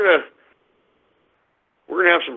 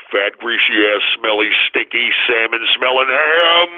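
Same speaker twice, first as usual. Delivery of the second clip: much lower heckler voice, stretching the last word; mocking taunt; 9 semitones lower than usual. Host heckles imagined scooter rider, low mocking taunt.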